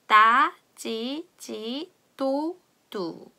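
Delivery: "따, 찌" very slow and spaced; slow drill. Shown as a demonstration. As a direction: stretch each syllable out. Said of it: "연습을 해볼게요".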